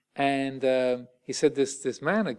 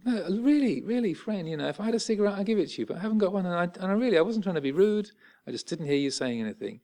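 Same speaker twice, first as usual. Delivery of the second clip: gentle pleading; 7 semitones higher, faster than usual. Retold or imitated. Reassuring the threatening man.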